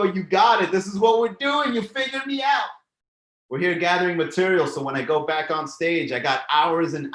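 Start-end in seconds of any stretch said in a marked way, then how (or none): 0.0-2.7 rising to a high, loud shout; gleeful, caught-out shout; excited shouting. Real, not acted. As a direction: call it out like you've been caught. Excited call-out to a viewer.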